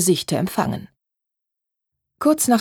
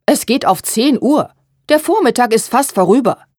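raised, higher, irritated voice; indignant impatience; 5 semitones higher, 5 dB louder than usual. A narrator's reading. Mother in the workroom waiting for Therese, indignant. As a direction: complain loudly about the lateness. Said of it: "die unwillige Stimme der Mutter".

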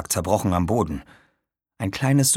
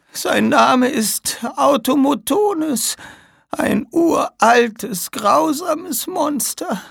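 put-on high, slow, strained old woman; slow ominous warning from a dying woman; 4 dB louder, slower than usual. (sterbende alte Frau, unheilvoll)

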